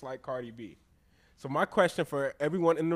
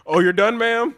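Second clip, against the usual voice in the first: lightly acted attendant, higher and louder; bathroom attendant; 7 semitones higher, 9 dB louder than usual. Playing the attendant waiting on a customer, mock-polite attendant. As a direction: offer service with mock politeness. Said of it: "I'm the attendee".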